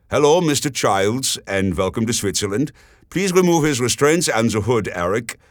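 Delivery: polite host with a German lilt; German accent. Acted. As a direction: welcome him with a German accent. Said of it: "said a new voice with a German accent".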